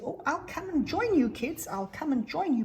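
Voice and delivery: high, eager bear voice; Jeff Bear, cheerful and willing. Acted.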